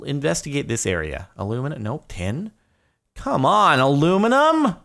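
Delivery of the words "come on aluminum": loud, high, pleading cheer at the screen; will the ore to appear, calling out; excited shout. Real, not acted. Gamer yelling at his mining screen, excited loud plea. Urging the game to drop aluminum.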